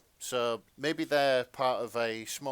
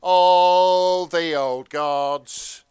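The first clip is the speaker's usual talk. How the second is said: very loud, very slow, higher proclamation; theatrical shout about old gods; mock doom intoning; 8 semitones higher, 17 dB louder, much slower than usual. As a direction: boom it out like a cult chant, hammed up. Joining the eldritch horror bit.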